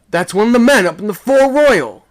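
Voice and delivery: high, loud urgent report; alarmed shout. A narrator's reading. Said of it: "I shouted".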